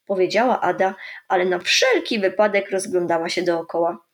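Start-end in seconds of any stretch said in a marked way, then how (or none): none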